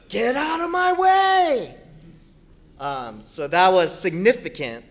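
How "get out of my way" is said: high, loud, slow commanding yell; booming shout. Retold or imitated. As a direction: bellow it like a honking truck. Repeats God's shout.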